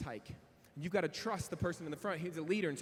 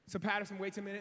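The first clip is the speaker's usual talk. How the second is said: ordinary talk, nothing marked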